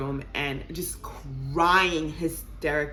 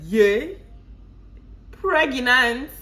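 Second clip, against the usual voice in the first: mock yay; 7 semitones higher, 6 dB louder, much slower than usual. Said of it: "I said".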